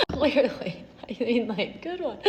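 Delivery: a self-mocking laugh into the word; laughing at herself. Real, not acted. Telling viewers about her blunder, laughing at herself.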